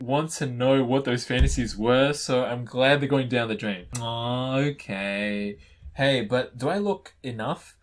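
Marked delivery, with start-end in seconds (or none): none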